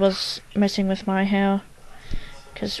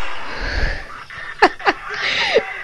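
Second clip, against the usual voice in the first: high, breathless giggle after screaming; laugh after a scare; 4 dB quieter than usual. Real, not acted. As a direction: laugh off the fright.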